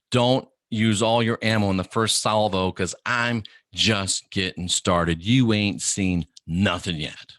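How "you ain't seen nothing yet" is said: slow taunt. Shown as a demonstration. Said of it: "slow emphatic taunt".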